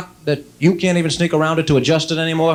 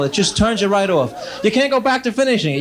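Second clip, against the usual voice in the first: ordinary talk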